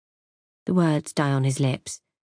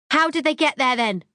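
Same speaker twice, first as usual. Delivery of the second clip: higher accusing shout; 8 semitones higher than usual. A narrator's reading. Wife flinging door open at husband, accusing shout. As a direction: hurl the question at him. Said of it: "I shout accusingly".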